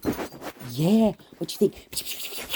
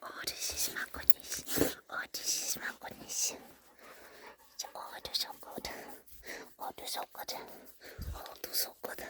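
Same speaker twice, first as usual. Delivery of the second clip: whispered praise; 14 dB quieter than usual. Real, not acted. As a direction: murmur it in a whisper.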